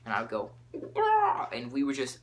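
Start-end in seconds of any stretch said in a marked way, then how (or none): 0.7-1.5 loud high squawking bird cry; bird call. Retold or imitated. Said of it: "I would make bird sounds".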